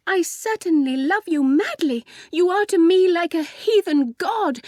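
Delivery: high, ardent love declaration; adoring cry. A narrator's reading. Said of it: "she cried".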